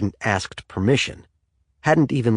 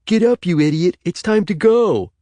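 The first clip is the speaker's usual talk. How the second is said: high, loud mocking imitation of a bossy brother; mock bossy Brian; 9 semitones higher, 5 dB louder than usual. Ricky mocking Brian's wake-up order, loud and high. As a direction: mimic him barking orders, hammed up. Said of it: "someone ... saying".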